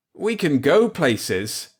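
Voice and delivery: high, slow, loud near shout; almost shout it with joy. Acted. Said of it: "I almost shouted".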